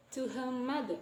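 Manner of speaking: "to her mother" with the R dropped into the M; "her mother" joined on one M sound; assimilated linking. Shown as a demonstration. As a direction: run "to her mother" together, no R before the M. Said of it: "we change it to the M sound".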